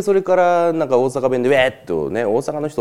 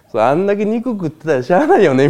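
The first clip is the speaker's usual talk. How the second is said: mock gossip; 9 semitones higher, 6 dB louder than usual. Imagined gossip after his death.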